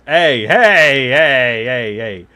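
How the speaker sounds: high, loud calls of hey; loud calling out. Real, not acted. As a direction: shout hey to get their attention. Calling out to the viewer.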